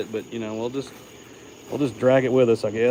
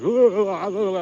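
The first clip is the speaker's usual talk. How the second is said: high squeamish little oohs; creeped-out ooh; 6 semitones higher, 5 dB louder than usual. Retold or imitated. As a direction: mimic shivering at a bug on your back. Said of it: "And you're just like".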